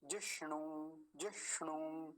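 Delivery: soft जिष्णु with half ष and long ū; long final u. Shown as a demonstration. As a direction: stop on ष, stretch the last vowel of जिष्णु. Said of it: "लंबा खींचेंगे दीर्घ उकार को".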